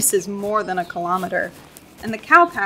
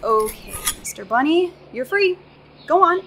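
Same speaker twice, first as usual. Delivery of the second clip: cheerful coaxing; 6 semitones higher, 4 dB louder than usual. Acted. Releasing rabbit.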